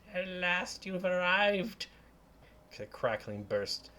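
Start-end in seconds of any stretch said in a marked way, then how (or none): 0.1-1.9 slow crackly crone voice. Acted. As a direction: croak it like an old hag. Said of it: "she, in a crackly voice".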